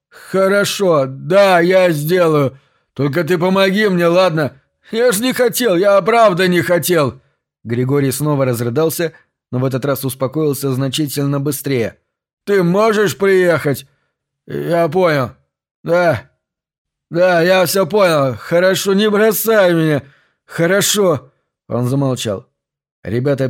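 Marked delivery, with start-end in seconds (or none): 0.1-7.2 tongue tangled, voice shaking and raised; slurred, trembling voice. A narrator's reading. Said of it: "Язык его по-прежнему заплетался, а голос дрожал". 12.4-13.9 pleading. A narrator's reading. Grigory pleading on phone. 14.5-21.3 raised, shaky phone plea; tearful pleading. A narrator's reading. Grigory crying on phone.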